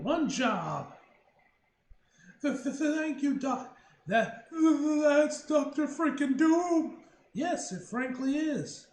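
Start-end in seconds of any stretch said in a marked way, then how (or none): 2.4-8.9 high eager gushing; excited stammer. Real, not acted. Excited meeting Doom.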